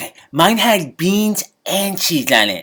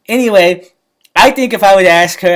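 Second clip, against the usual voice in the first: usual speaking voice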